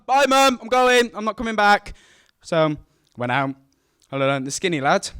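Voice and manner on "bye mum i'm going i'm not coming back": much higher, louder eager yell; excited shout. Retold or imitated. Boy shouting goodbye to mum as he runs out, loud and high. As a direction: burst out the door hollering. Kid racing out the door.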